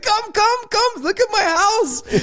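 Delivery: high, slow coaxing mock voice; eager host. Gangster inviting the guests in, high and coaxing. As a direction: beckon them in like an eager host.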